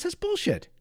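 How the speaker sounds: high, dismissive scoffing jab; blunt Trump-type guy. Retold or imitated. Trump watching government fail, high dismissive scoff. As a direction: toss it off like a guy sick of the government. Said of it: "he's one of these guys who says".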